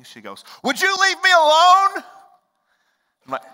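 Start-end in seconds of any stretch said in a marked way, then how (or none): 0.6-2.0 imitated yell. Freaking-out sister to brother checking on her, shrieked. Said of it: "I kinda yelled at you".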